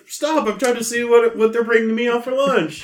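high, loud exasperated master; high put-upon master voice; master's voice. Acted. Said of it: "he's just like".

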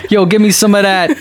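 a hint of mock rapper talk; mock hip Bible. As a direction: read scripture as hip slang. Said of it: "it actually says dat".

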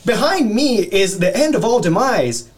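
high, slow gloomy tour guide; cheerful guide who hates his life; theme park ride spiel. Pretend. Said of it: "It's just like".

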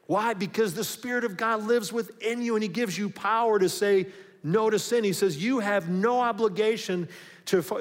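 rising sermon climax; fervent preaching. Acted. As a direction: preach it out with fire. Preaching at full force.

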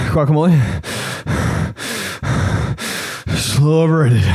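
loud mock panting between words; exaggerated out of breath. Comic showing how winded he is, exaggerated panting. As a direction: heave the words out between huge gasps. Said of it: "I'm so out of breath".

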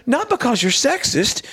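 high-pitched stand-up delivery, imitated; retold comedian. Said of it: "he's a comedian".

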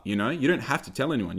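ordinary delivery

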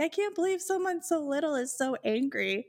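high, whimpering imitation; mock tearful. Boyfriend's mom crying in the corner, mock tearful. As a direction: imitate a crying mom's wail, lightly. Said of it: "in the corner crying".

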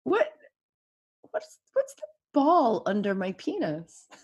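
lightly voiced small boy, curious; a hint of a toddler's sweet question; small son asking his mom, in a light sweet little voice. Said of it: "in that same sweet little voice".

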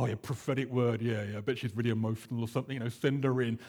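low, fast, dismissive mock patronizing. Wimber brushing off the prophet lady, lightly voiced low patronizing. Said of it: "Like, really patronizing".

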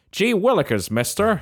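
hammed-up wholesome small-town exclamation; gosh-golly vibe. Playing the homely barbecue guy, hammed-up gee-whiz. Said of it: "That's the kind of vibe".

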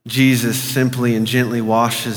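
hushed imaginative prayer guide; guided meditation. Acted. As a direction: lead the listener gently through the scene.

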